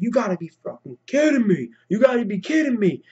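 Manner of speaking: high, strained outraged yell; reenacting screaming at his boys over the trade; retold screaming. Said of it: "I was freaking screaming here".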